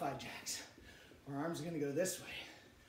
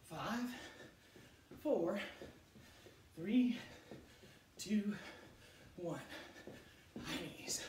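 pumped-up workout coach; aerobics instructor; slower than usual. Real, not acted. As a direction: push the class through the reps. Leads the high knees exercise.

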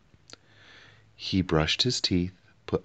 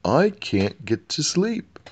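much higher, slower wail; wailing king; 10 semitones higher, 4 dB louder, slower than usual. A narrator's reading. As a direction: wail it like a tired child. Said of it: "wailed the king".

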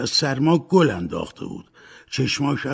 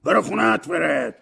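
loud, higher snap to get out; angry order; 6 semitones higher, 6 dB louder than usual. A narrator's reading. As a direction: shout him out the door. Uncle ordering nephew out.

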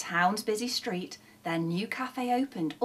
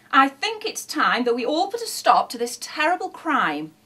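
higher, louder, indignant declaration; cross; 6 semitones higher, 7 dB louder than usual. A narrator's reading. Neighbour rallies the others against thieves, cross. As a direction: declare it in outraged protest. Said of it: "he said crossly".

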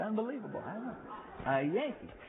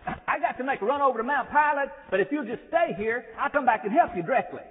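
a friendly holler, pitched higher and louder; hollering out the window; 5 semitones higher, 5 dB louder than usual. Retold or imitated. The trooper hollering to Chuck from the passing car, loud and high. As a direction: call it out the car window while driving off. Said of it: "he commences hollering out the window".

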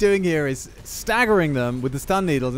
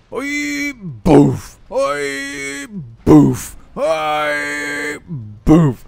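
bouncing noises; 4 dB louder, much slower than usual. Pretend. Player trampolining his tank on ice, imitated bouncing. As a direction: mimic each bounce and thud.

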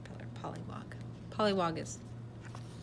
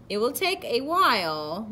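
higher, louder, emphatic caution; warning; 6 semitones higher, 12 dB louder, slower than usual. A narrator's reading. Caterpillar cautioning the eager pollywog, warning. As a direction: warn them to be patient. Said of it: "the caterpillar warned".

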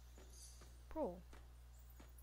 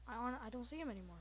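ordinary talk

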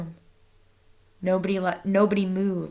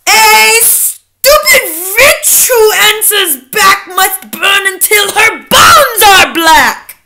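slow, high, thunderous witch curse; screamed; 8 semitones higher, 11 dB louder, slower than usual. A narrator's reading. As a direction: scream it out slowly like a death sentence. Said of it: "she screamed".

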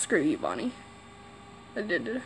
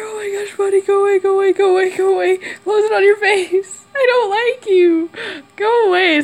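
panicked pleading; 7 semitones higher than usual. Real, not acted. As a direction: beg the monster to leave, panicking. Monster in face, panicking.

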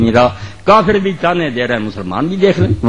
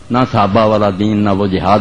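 ordinary talk

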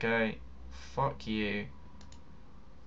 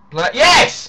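excited cheer; 11 semitones higher, 11 dB louder than usual. Real, not acted. Sudden cheer.

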